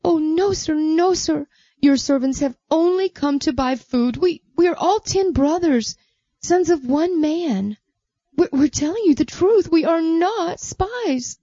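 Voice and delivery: high, flustered protest; pleading defence. Acted. The brothers defending themselves before Joseph, high and pleading. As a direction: stammer out a desperate denial. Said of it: "The brothers all defended themselves".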